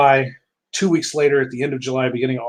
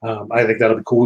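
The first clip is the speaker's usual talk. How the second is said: ordinary delivery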